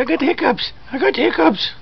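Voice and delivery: high, mock baby voice; voicing the baby. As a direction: speak for the little one, high and childlike. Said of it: "I said".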